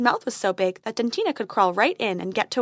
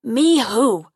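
growling dragon guarding treasure; 7 dB louder than usual. Acted.

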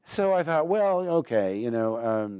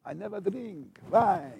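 slow, hushed Hungarian vampire drawl; Bela Lugosi; 7 semitones higher, 3 dB quieter than usual. Retold or imitated. Recalling Dracula films, imitates the count slowly. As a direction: draw it out like old Dracula. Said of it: "the first time I saw Dracula, Bela Lugosi".

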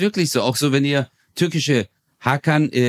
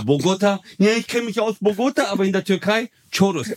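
higher, mock-outraged Turkish rant; mock indignant Turkish guy; 6 semitones higher than usual. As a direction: play up the offended Turkish friend. Mock rant about German mispronunciation.

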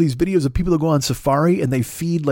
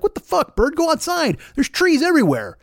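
very high-pitched indignant protest; mock exasperation; 11 semitones higher than usual. Homeowner to the nesting bird, mock exasperation. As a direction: plead in a squeal of disbelief.

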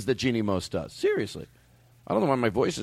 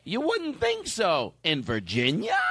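exaggerated puff-piece reporter, very slow; hammy, drawn-out TV feature voice; Jeannie Mose; 8 semitones higher, slower than usual. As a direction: swoop high on the teaser questions. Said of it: "You know, it's like".

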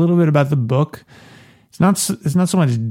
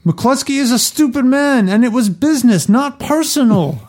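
much higher put-on character voice; high Hagen voice; 11 semitones higher than usual. Reader voicing Hagen's advice to Michael in a high put-on voice. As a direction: read Hagen in a silly high voice.

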